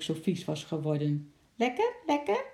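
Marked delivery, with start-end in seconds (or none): 1.5-2.4 very high parrot question; asking parrot. A narrator's reading. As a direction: squawk it as a rising parrot question. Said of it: "vroeg Lorre". (hoge, vragende papegaaienstem)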